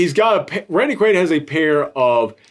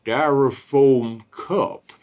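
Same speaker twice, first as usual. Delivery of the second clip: deadpan line delivery; 4 semitones lower, much slower than usual. Retold or imitated. Reviewer imitating Randy Quaid's line in the movie, slow deadpan. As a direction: drop the line flat and drawn out, like it means everything. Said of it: "that line delivery".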